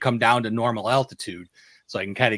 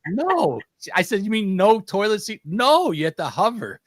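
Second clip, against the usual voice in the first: mock exasperation; 10 semitones higher, 5 dB louder than usual. Acted exchange with exasperated wife.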